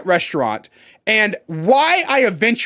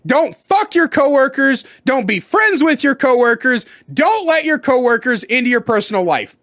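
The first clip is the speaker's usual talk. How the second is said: normal talking voice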